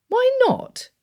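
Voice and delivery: very high, insistent question; aunt scolding her nephew; aunt demanding. A narrator's reading. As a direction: fists on hips, why not. Aunt hands on hips.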